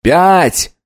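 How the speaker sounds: high, urgent number call; countdown call. Acted. Countdown called before salvo.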